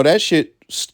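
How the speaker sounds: ordinary talk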